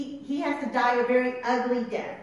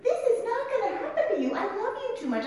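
high-pitched, acted loving protest; Peter; 12 semitones higher, 3 dB louder than usual. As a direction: plead urgently out of love, playing it up. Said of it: "That's why Peter says this, right? In love".

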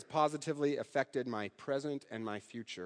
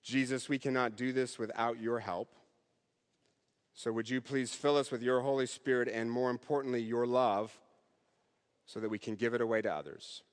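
softer, lower prayer voice; closing prayer; 4 semitones lower, 4 dB quieter than usual. Acted. Preacher leads the congregation in a closing prayer, softly. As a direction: pray it softly, reverently.

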